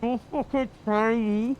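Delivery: put-on snarling Welsh accent, muffled; aggressive Welsh accent. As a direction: threaten him in a hard Welsh accent. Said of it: "aggressive Welsh accent".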